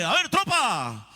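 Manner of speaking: imitating an officer calling the troops; mock military command. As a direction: bark it like a drill sergeant. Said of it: "Y le dije".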